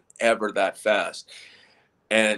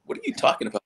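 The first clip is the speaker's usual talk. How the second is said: baffled; 13 semitones higher, much faster than usual. Retold or imitated. Retold baffled reply to hypnotist.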